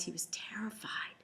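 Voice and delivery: hushed narration; whispered. A narrator's reading. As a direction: whisper the suspense. Whispered in the scary moment.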